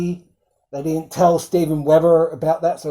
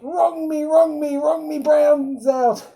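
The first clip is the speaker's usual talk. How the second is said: much higher, pleading put-on voice, slow at the end; begging Renfield; 11 semitones higher, slower than usual. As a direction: beg like a giddy crazed servant. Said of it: "he's like".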